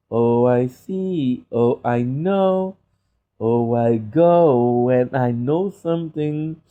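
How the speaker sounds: slow, singsong mumbling to self; slow sing-song. Real, not acted. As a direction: croon it lazily to yourself. Repeated sing-song musing.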